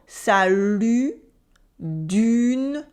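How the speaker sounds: "salut" and "dune" with a rounded front vowel; French U. Shown as a demonstration. Teacher giving examples, fronted French U in "salut" and "dune". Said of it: "lips are rounded and pushed forward".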